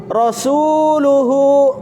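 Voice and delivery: rasuluhu, slow and careful; slow demo. Shown as a demonstration. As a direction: read rasuluhu slowly.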